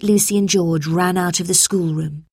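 ordinary delivery, nothing marked

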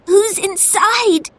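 high, drawn-out question after a cough; cough, then ask slowly in a high child's voice. A narrator's reading. Lucy asking who is in the burning house, coughing.

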